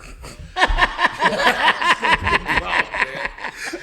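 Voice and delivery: howling high laugh; real loud laugh breaking out; loud laughter. Real, not acted. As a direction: burst out laughing hard. Big laugh at shoveling story.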